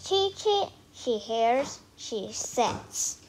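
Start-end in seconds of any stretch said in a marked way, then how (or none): 0.1-0.7 high little chick peeps; chick cheeps. Acted. Ducklings answering their mother, high chick peeps. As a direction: peep like a tiny duckling, pitched up.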